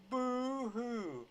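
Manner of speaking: high-pitched, stretched mock sob; mocking boo-hoo. Commentator jeering about immigration complaints, mock crying. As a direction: draw it out like pretend crying.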